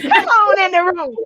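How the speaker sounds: host cheering in her own voice; excited call. Real, not acted. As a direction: echo it with her own fire. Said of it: "host repeats the call herself".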